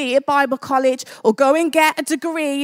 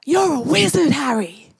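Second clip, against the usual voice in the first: hammed-up accent for a film giant, falling on the name; dubious Hagrid accent; 2 semitones lower than usual. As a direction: put on a wobbly, uncertain movie accent. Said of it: "I don't know what accent that was".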